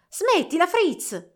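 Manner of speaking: grido molto più alto e forte. A narrator's reading. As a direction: ferma tuo fratello gridando. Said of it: "gridò Clara". (Clara grida a Fritz di smettere, voce alta e forte)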